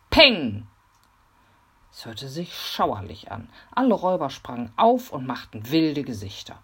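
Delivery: plain reading voice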